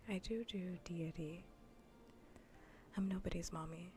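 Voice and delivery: normal talking voice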